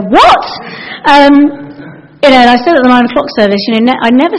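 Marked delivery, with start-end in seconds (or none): 0.1-0.5 high, loud rising "What?"; shocked. Acted. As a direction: blurt it out in disbelief. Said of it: "I'd probably go".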